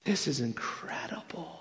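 breathy near-whisper of wonder; hushed awe. Real, not acted. Preacher marveling at the verse just read, hushed. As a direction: whisper it in amazement. Awed aside after reading the verse.

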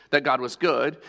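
ordinary talk

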